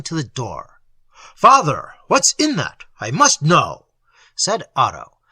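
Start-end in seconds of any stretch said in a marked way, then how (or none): 1.3-3.9 insist, gathering resolve; determined. A narrator's reading. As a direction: son demanding to see the parcel. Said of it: "the first signs of determination".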